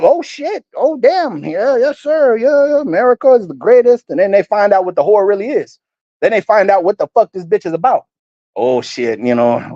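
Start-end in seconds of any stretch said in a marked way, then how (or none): 0.0-4.1 higher, eager mock flattery; a hint of hammed-up awe; fawning nations. Nations fawning over America. 8.6-9.7 a touch of hammed-up regret; dismayed nations. Nations regretting their alliance.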